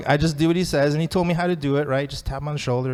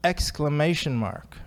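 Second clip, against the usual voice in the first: usual speaking voice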